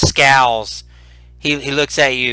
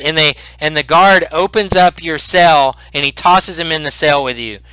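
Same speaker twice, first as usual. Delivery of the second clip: ordinary talk, nothing marked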